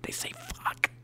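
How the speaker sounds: put-on high kid voice dropping to a whisper; excited ten-year-old. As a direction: imitate a kid calling parents, then whispering the swear. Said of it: "kind of excited".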